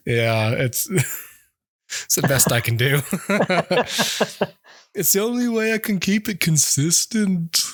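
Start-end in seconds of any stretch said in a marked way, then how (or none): 5.0-7.6 lightly held put-on high singer voice; Walk Hard impression. Host teasing the guest, in a high put-on voice. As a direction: stay in the Walk Hard character voice. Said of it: "it slips out into my own voice".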